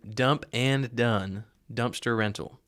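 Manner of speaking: "Dump and Done Dumpster Rental" enunciated slowly, word by word; careful pronunciation. Real, not acted. Host introducing the guest's company, "Dump and Done Dumpster Rental" carefully pronounced. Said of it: "that's the beautiful pronunciation".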